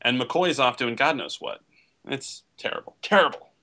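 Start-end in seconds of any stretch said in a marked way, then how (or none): 2.1-2.9 whispered disgust. Real, not acted. Disgust at the film. 3.0-3.5 emphatic repeat. Real, not acted. Repeated with force.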